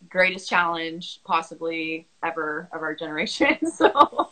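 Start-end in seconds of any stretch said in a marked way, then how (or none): 3.6-4.3 laughing nervously; nervous giggle breaking in. Real, not acted. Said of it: "nervous laughter".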